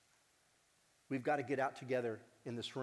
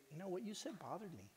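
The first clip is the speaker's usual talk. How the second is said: softer, gentle and direct; soft honest complaint; 5 semitones higher, 9 dB quieter than usual. Pretend. Telling a friend softly he hurt you. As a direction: say it calmly, not to fight. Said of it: "but to say".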